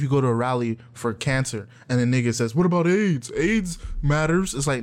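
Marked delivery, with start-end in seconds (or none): 2.5-4.5 higher, slow mock heckler voice; dumb heckler. Heckler at cancer rally, slow clueless voice. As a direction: play a clueless heckler, dragged out.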